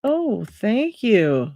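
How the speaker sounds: usual speaking voice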